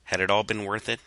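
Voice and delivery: ordinary delivery, nothing marked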